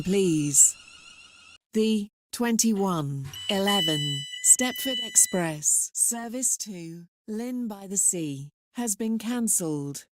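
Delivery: ordinary talk, nothing marked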